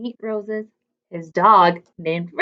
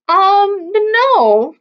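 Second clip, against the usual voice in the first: a little higher, halting um and no; nervous stutter, slightly raised pitch; 7 semitones higher, 4 dB louder than usual. A narrator's reading. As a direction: stammer it out, caught off guard. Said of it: "stuttered Bob".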